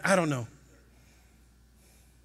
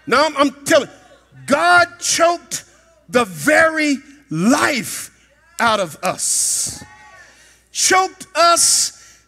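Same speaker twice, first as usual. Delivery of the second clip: full-force preaching; 3 semitones higher, 5 dB louder, slower than usual. Acted. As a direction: preach it with rising fire, stretching the words.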